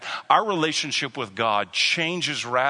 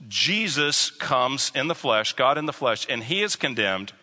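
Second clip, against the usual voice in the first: ordinary delivery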